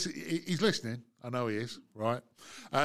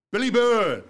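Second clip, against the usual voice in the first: announcer introduction; 7 semitones higher, 8 dB louder than usual. Acted. Host introduces guest.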